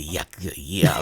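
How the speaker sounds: low, slow, wet-sounding weird voice. Shown as a demonstration. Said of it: "in a real weird voice".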